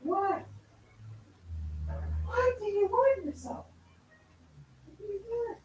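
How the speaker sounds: high, sing-song voice for the bird; pet talk. Real, not acted. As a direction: coo at your little pet. Talking sweetly to a pet bird.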